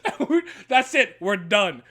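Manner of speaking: laughing through the words. Real, not acted. Host laughing at his galaxy joke, laughing. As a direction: laugh out the punchline.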